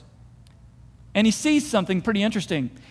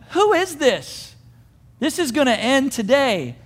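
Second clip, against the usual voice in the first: raised, defiant challenge; indignant resolve; 6 semitones higher than usual. Acted. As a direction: throw it down high and determined. Acts David's indignant resolve.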